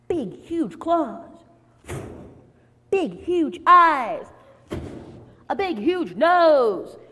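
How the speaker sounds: loud, high, drawn-out words; slow, punchy, wide-eyed storytelling; awed monster description. A narrator's reading. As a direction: stretch each feature out in big amazement. Describing the frightening wolf.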